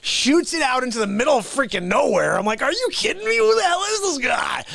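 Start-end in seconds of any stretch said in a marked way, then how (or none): 2.6-4.6 high-pitched retold freak-out; alarmed disbelief. Musician reacting to his buddy's gunshot, retold alarmed disbelief. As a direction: act out shocked disbelief, voice shooting up.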